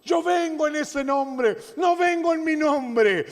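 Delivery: slow, high declaration; emphatic proclamation. Acted. Pastor repeats David's claim to the congregation, slow and high. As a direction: proclaim it slowly from the pulpit.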